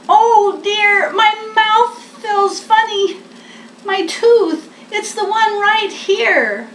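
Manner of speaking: high, worried little bear voice. Acted. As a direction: Bear fretting about his loose tooth. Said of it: "Bear pointed in his mouth and he said".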